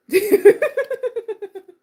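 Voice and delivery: high, bursting laugh; loud laugh. Real, not acted. Coach laughing at scaring everyone off, loud high laugh. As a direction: laugh it off after the rant. Laughing at her own rant.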